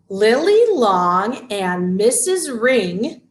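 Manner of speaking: slow sing-song chant, each finger named; finger-play rhyme. Shown as a demonstration. Teacher chants a finger rhyme for parents and babies, slow sing-song. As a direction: chant it slowly while wiggling each finger.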